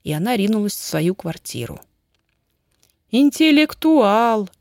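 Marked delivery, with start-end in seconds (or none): 3.1-4.5 loud, high, stretched-out accusation; набрасываясь с упрёком. A narrator's reading. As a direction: throw the word at him, slow and loud. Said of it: "набросилась она на Петьку". (возмущённый упрёк)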